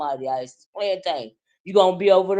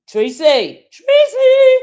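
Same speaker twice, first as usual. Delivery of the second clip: shouted name, much higher and louder; calling out; 10 dB louder, much slower than usual. Real, not acted. Calling out to Tracy by name, loud high shout. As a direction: call her name across the room.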